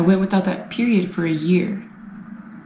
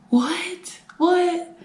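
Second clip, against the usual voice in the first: much higher, disbelieving what, twice; incredulous what; 11 semitones higher than usual. Retold or imitated. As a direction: squeak it out in mock disbelief. Disbelief at her own idea.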